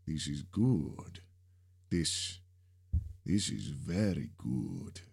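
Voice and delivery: slow, savoring villain with mild excitement; excited. A narrator's reading. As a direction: relish it, understated glee. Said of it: "sounding excited".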